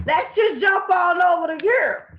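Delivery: loud announcement. Real, not acted. Loud topic switch, shout heard.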